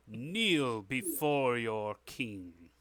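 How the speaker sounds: slow, weighty royal command; stately, stretched-out kingly order. Acted. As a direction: draw out each word to make them kneel. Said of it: "the king calls out".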